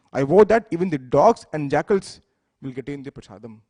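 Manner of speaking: loud, declaiming vow of a furious goddess. A narrator's reading. Parvati furiously vows before the saints to share prasadam, loud declaration. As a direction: proclaim it loudly before the assembly of saints. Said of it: "she became furious and said".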